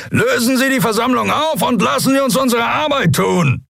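high-pitched bellowed command; roared order. A narrator's reading. Captain orders the protesters to disperse, roaring. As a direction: shout it out over the crowd. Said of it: "brüllte er".

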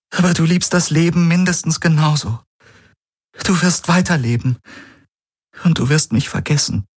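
she lets him go gently; Ki, sad and resigned. A narrator's reading. Ki tells Galen he will forget her, sadly.

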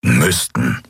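low, snorted echo of "Müssten"; sceptical snort. Acted. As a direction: throw the word back low and sceptically. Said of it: "schnaubte Keru".

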